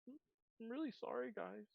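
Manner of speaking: small, high, soft apologetic voice; meek, sorry little voice; sheepish Blackberry. Acted. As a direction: apologise timidly, high and quiet. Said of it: "looks very sheepish".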